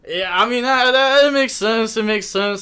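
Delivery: bursting to agree; real excited rush; excited reply. Real, not acted. Excited reply to chat compliment.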